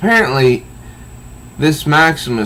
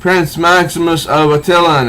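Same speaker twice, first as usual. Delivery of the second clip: higher, louder chanted answer; crowd yelling; 8 semitones higher, 6 dB louder than usual. Retold or imitated. Mind-controlled crowd answering Maximus, mock yell. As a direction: shout it back like an entranced crowd. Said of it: "they all yell out".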